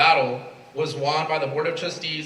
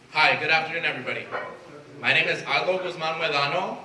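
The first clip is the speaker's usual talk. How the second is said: normal talking voice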